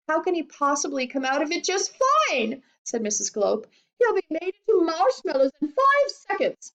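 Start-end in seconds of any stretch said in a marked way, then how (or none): 0.1-2.6 high, indignant protest; high-pitched disbelieving mother. A narrator's reading. Frantic mother challenges Wonka. 4.0-6.7 high, alarmed protest; high-pitched panicking mother; frantic mother. A narrator's reading.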